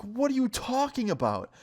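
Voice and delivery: can't believe what he's hearing; high, incredulous what-are-you-on-about; exasperated disbelief. Retold or imitated. Repeating confused reply, exasperated.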